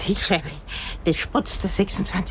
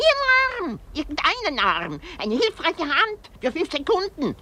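exasperated outburst; 11 semitones higher than usual. Acted. Exasperated plea for an arm.

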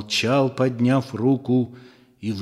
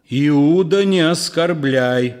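louder, threatening growl; growled warning; 4 semitones higher, 4 dB louder than usual. Acted. Peter warning Judas, angry growl. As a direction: warn him off, rumbling with anger. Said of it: "прорычал Пётр".

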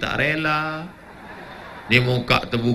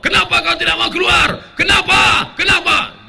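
higher, louder, angry demand; demanding shout; 14 semitones higher, 6 dB louder than usual. Acted. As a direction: bark at the jinn. Peruqyah membentak jin. (bentakan keras kepada jin)

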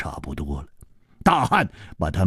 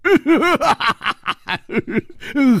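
high, long burst of laughter; hearty laugh. A narrator's reading. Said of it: "这个大汉反而笑了".

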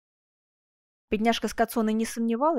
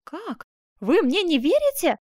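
higher, taken-aback question; baffled, with a sharp rise; puzzled exclamation; 7 semitones higher, 6 dB louder, slower than usual. A narrator's reading. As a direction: exclaim in startled disbelief. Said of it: "воскликнула озадаченная Скацоне".

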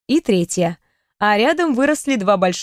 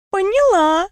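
со смешком; 9 semitones higher, slower than usual. Acted. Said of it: "хохотнула девушка".

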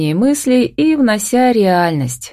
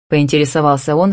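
ordinary delivery, nothing marked

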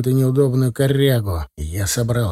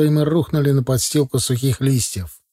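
plain reading voice

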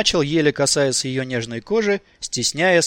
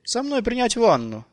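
high, patient woman's voice; patient repeat; 8 semitones higher than usual. A narrator's reading. Nicole patiently repeating her invitation, high-voiced. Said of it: "терпеливо повторила она".

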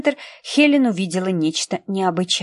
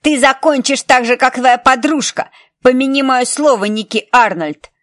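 louder and higher, angry shout; yelling; 4 semitones higher, 6 dB louder than usual. Acted. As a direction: bellow it from the corner. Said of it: "заорал".

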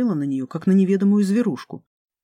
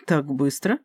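low-key surprised question; mildly surprised; 3 semitones lower, slower than usual. A narrator's reading. Dark god hearing his lecture is in an hour, mildly surprised. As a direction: ask it with understated surprise. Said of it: "удивился Каин".

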